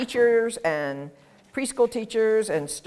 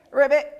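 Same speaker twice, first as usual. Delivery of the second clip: ribbit; 6 semitones higher, 5 dB louder than usual. Pretend. Frog sound demo.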